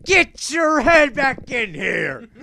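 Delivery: high, loud, stretched-out shouting; mock frantic yell. Fat Man yelling at friend filming from window, hammed-up panic. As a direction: bellow it slowly like a panicking friend.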